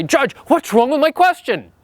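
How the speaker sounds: high, protesting whine at the judge; indignant wrong response. Retold or imitated. Novice attorney protests to the judge, indignant and high. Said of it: "That's the wrong response".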